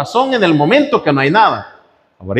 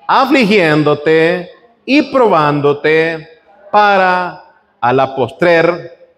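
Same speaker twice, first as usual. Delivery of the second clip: slow, deliberate reading of the verse; much slower than usual. Acted. Preacher reading verse sixteen slowly to the congregation. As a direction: stretch the words out so they sink in.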